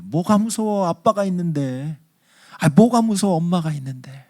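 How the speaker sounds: high-pitched gentle chiding; reassuring parents. Acted. Parents to scared child, chiding reassurance. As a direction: brush the fear away like a parent. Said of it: "엄마, 아빠가 뭐라 그래요?".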